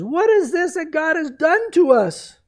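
high, loud panicked question; startled, guilt-stricken outburst; alarmed cry. Retold or imitated. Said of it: "unusual response when he says".

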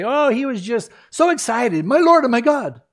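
mock it as a giddy, flippant cry; mock excited exclamation. Preacher mimicking the JW reading of Thomas, hammed-up excited cry. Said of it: "that's what the J W say".

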